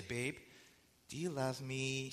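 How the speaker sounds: mock-sweetly fishing for a favor; wheedling question. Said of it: "She'll say it just like that".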